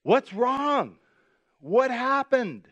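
a touch of worried surprise; concerned. Retold or imitated. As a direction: ask what happened, alarmed. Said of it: "And I said".

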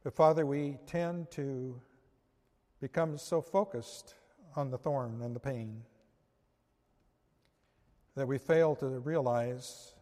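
soft, hushed closing prayer. Acted. Preacher praying to the Father for his congregation, softly. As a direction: pray gently, drawing the listeners in.

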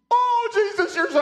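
high mock scream of praise; retold scream. Dying friend crying out to Jesus in a high voice. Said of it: "he just starts screaming".

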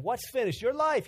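high, scornful mock; mocking scoff. Onlookers mocking Jesus on the cross, scornful. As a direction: sneer at the claim. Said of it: "They said".

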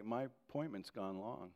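normal talking voice

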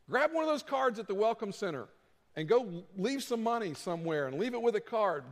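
ordinary talk, nothing marked

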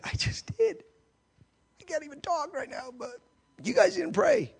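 high, hushed, strained squeak; lost voice. Retold or imitated. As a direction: act out a voice worn out from screaming. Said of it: "I'm, like, losing my voice".

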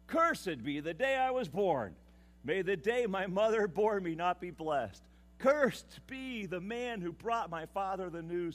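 loud, declaiming curse; cursing. Acted. Preacher reading Jeremiah's curse on his birth, loud. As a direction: proclaim the curse with full force.

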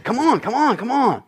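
playfully insistent prodding; acted coaxing. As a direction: keep nagging a reluctant child along. Acted coaxing of unwilling children.

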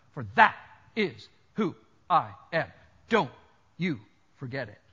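low, word-by-word delivery with pauses; firm, emphatic warning. Acted. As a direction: drive each word home separately. Jesus' claim driven home word by word.

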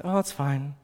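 soft, half-hearted acceptance; dismissive self-reassurance. Acted. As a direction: quietly pretend all is fine. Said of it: "to just kinda say".

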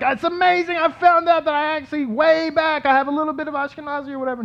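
a hammed-up amazed newcomer; amazed visitor. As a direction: gush like someone who just found their roots. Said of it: "they come back to her and they're like".

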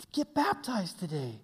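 normal talking voice

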